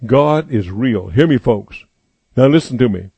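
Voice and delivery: normal talking voice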